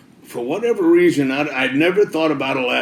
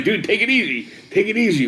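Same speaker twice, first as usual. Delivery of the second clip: higher-pitched imitation of a friend protesting; acted-out "settle down", pitched up; retold placating; 6 semitones higher than usual. Woken friend calms him down, retold.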